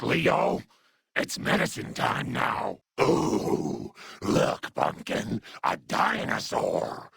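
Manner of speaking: ordinary talk, nothing marked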